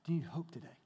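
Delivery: normal talking voice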